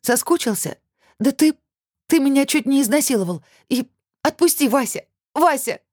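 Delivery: very high, agitated pleading; desperate protest. A narrator's reading. Woman pinned by her ex, desperate high protest. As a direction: beg and accuse in a high voice. Struggling against her attacker.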